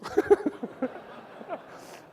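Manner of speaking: high-pitched burst of laughter. Real, not acted. Speaker laughing at nurse's lethal dose joke, high laugh.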